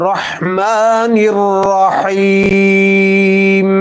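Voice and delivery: রহমানির রহিম, ha from mid throat, slow; slow chanted রহমানির রহিম, ha from the throat. Shown as a demonstration. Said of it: "গলার মধ্য থেকে উচ্চারণ করতে হবে".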